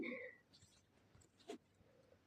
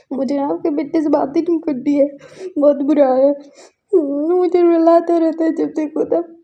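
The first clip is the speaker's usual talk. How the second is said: crying complaint; 5 semitones higher, 5 dB louder than usual. Acted. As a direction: complain through tears. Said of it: "बोल के वो रो रहा था". (रोते हुए ऊँची शिकायत)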